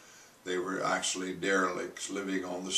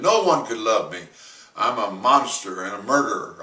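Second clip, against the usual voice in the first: louder anguished protest; despairing outburst; 7 dB louder than usual. Retold or imitated. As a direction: push back against any comfort. George despairing, refusing comfort.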